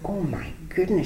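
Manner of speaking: breathy imitated whisper of a prim lady; whispered exclamation. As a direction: hush it out like a shocked prim lady. Employer's prim reaction retold.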